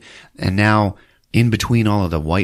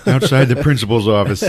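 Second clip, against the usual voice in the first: ordinary talk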